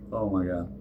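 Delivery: holding back a sneeze; talking through a sneeze coming on; about to sneeze. Real, not acted. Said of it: "about to sneeze".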